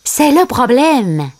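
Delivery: slow, high character voice; Timon. A narrator's reading. Timon admits the snag to Simba, slow high voice. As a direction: admit it slowly in a lightly voiced sidekick role. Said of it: "dit Timon".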